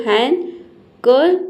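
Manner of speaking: Handkerchief in three slow parts, "han... ker... cheef"; syllable by syllable. Shown as a demonstration. Teacher shows three syllables, slow broken-up word. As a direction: break handkerchief apart with pauses between syllables. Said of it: "तीन syllable है".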